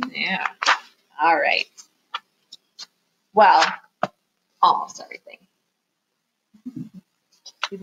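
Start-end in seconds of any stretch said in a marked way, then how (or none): none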